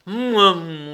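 loud mumbled bear noise; storybook bear grunt; growling bear. A narrator's reading. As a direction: growl it with a humming mouth. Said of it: "grommela l'ours".

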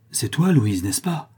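swept-up, eager questioning; mildly carried away; impulsive exclamation. A narrator's reading. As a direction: exclaim it as you embrace your daughter. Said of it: "s'écria-t-il avec entraînement".

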